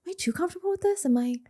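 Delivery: worried self-doubt. Retold or imitated. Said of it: "you're like".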